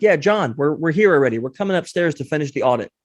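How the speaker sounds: ordinary delivery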